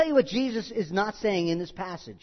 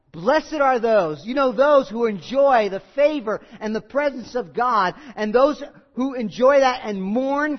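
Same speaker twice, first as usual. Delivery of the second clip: preaching; 2 semitones higher, 5 dB louder than usual. Acted. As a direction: drive the verse home from the pulpit. Preaching at full force.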